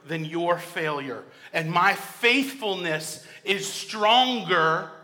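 loud, slow, proclaiming shout; a preacher voicing God's loud shout of forgiveness. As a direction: declare forgiveness like God calling out. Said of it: "The shout of God saying".

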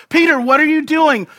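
high, loud incredulous challenge; mock incredulity. Preacher calling out Peter's clumsy swing, mock incredulous. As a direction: exclaim it as if appalled.

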